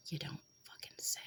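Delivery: breathy whispered sarcasm; sarcastic whisper. Real, not acted. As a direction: mutter it under your breath, dripping sarcasm. Sarcastic aside to camera.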